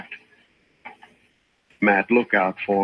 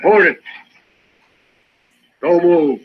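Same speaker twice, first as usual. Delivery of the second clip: loud, higher warning call; sharp challenge; 6 semitones higher, 4 dB louder than usual. Acted. Bert warns unseen men to freeze, calling out loud. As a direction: stop them cold from the dark.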